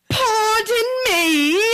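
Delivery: put-on very slow, high and loud British accent; hammed-up, drawn-out affronted English mom; mock indignant English mom. Mom looking dead at Emily, mock indignant English accent. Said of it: "looks at her and goes".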